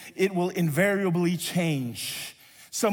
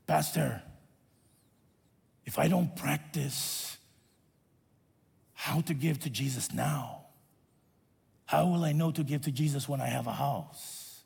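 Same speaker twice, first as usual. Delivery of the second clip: Hugo's voice; 5 semitones lower, 5 dB quieter than usual. Retold or imitated. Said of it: "his voice is like this".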